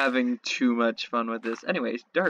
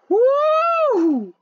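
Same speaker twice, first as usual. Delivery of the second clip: much higher, louder cheer; whoop; 7 dB louder than usual. Real, not acted. Player relieved after a close call, loud high whoop. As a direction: let out a relieved victory whoop.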